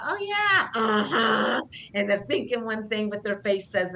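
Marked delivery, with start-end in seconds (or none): 0.0-1.6 much higher, sing-song fake cheer; sweet on top, sour underneath; hammed-up insincere uh-huh; fake smiling agreement. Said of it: "they might be smiling".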